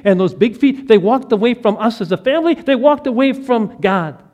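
loud, high, grief-stricken telling; anguished father. Retold or imitated. Grieving father telling Anna about his lost son, loud and anguished. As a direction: pour out a father's heartbreak. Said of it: "He's just sobbing uncontrollably".

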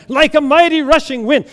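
high, loud, ecstatic cry; fired-up worshipper. Acted. Woman in front row answering the pastor, carried away. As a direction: call it out at full fervor. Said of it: "she's getting all fired up for Jesus".

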